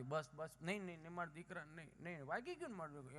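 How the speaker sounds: soft, cooing comfort; soothing mother. Retold or imitated. Mother comforting her fallen son, soft and tender. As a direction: hush the child gently.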